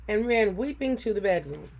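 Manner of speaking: plain reading voice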